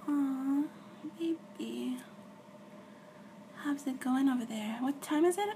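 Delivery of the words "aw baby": ordinary talk, nothing marked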